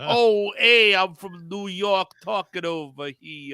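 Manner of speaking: mock Brad Pitt's clumsy New York accent; bad New York accent. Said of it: "bad Brad Pitt New, New York accent".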